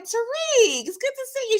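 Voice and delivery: lightly voiced fake-polite grandma; sweet mock greeting. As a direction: put on a sweet hostess voice. [name] greeting Tariq at door.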